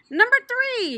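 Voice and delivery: high, loud called-out number; hollered. Acted. Cake lady hollering out the winning number. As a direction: call it across the room. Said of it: "she hollered out".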